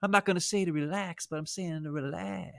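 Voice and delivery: lightly mock a chill guru telling people to relax; a hint of a stoned surfer; laid-back dude impression. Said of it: "'Cause he's like".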